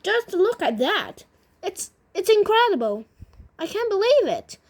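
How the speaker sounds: high, loud amazed storybook yell; acted astonishment, pitched well up; excited yelling. A narrator's reading. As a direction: yell in sheer disbelief. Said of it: "Grandpa Joe was yelling".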